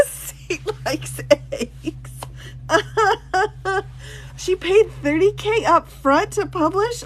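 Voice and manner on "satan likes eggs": laughing. Real, not acted. Cracks up reading the book.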